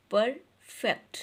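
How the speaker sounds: PERFECT in two separate beats, per, fect; split into syllables. Shown as a demonstration. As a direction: break "perfect" after per, with a short pause. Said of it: "टू syllable word".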